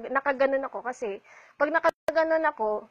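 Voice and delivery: ordinary delivery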